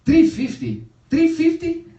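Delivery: loud, high mock disbelief; outraged haggler. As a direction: cry out the price in mock outrage. Dad outraged at the price.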